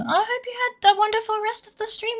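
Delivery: high cutesy voice. Acted. Greeting the raiders from another stream in a high cutesy voice. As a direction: pitch it way up like a cute mascot.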